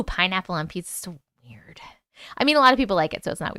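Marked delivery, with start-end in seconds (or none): 0.0-1.8 hushed whispered aside to chat. Real, not acted. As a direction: whisper it like a guilty secret. Reacting to pizza topping in chat.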